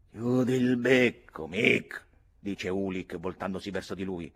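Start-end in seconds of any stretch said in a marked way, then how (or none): 0.1-2.0 loud, slow, raised command; stern silencing. A narrator's reading. Ulick turns on Mick to silence him, stern shout. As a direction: cut him off with a drawn-out order.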